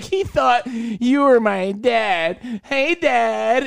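high, drawn-out mocking imitation; slow, high sing-song teasing; teasing friend. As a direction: stretch it out to rub it in. Acts out Jordan teasing him.

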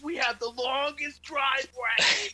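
high slow pompous boast; proud dad voice. Retold or imitated. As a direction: boast like a smug homeowner. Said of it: "you're like".

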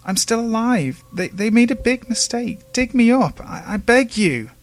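high, loud stammering plea; desperate pleading. Acted. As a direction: beg frantically to be dug up. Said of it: "cried the voice".

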